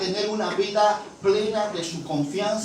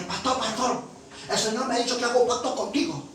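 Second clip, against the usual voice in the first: higher, quick acted caller; an insistent woman bursting in with news; eager, hurried appeal; urgent pleading; 6 semitones higher than usual. Acts out urgent woman at door.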